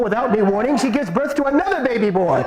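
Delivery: plain reading voice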